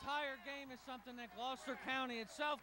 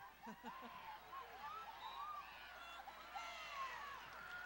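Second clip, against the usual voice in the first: hearty laugh; 10 dB quieter than usual. Real, not acted. Laughs at fouling joke.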